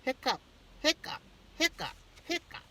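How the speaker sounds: high, bouncy hiccups with each hop; hopping hiccups. Acted. Mister Penny hopping on one foot, hiccuping. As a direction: hiccup on every landing. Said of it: "he hiccuped".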